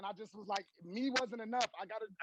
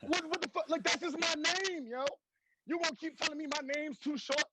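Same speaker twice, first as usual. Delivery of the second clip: incredulous outrage; 10 semitones higher than usual. Retold or imitated. Retold outrage at name complaints.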